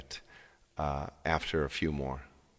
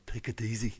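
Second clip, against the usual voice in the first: slightly higher imitation of a film character; My Left Foot lead impression; 4 semitones higher, 3 dB quieter than usual. Singer quoting My Left Foot to the audience, light impression. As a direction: lightly mimic how the film character talked. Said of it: "that's the way he talked".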